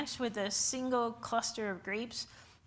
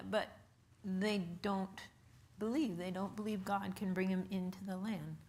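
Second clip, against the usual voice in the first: sad; 4 semitones lower, 6 dB quieter than usual. Real, not acted. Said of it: "Very sad".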